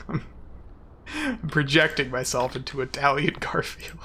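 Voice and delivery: usual speaking voice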